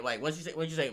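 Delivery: ordinary talk, nothing marked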